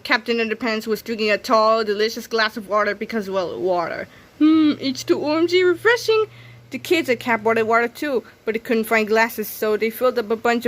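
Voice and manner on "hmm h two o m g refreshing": higher, pleased heroic voice; hammed-up satisfaction. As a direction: savour the drink theatrically. Hero savors water.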